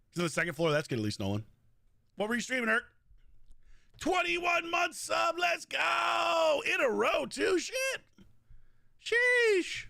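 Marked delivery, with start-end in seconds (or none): 4.0-7.8 drawn-out, high hype shout; excited shout. Real, not acted. Hyping a sub alert. 9.0-9.9 high, louder drawn-out sheesh; hyped sheesh. Real, not acted. Streamer reacting to a sub streak, high loud sheesh.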